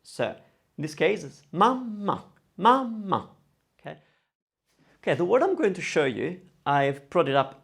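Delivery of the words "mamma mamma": mamma with a break before the held m; double m. Shown as a demonstration. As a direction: suspend the first a, then land on the m. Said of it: "take a break in the syllable".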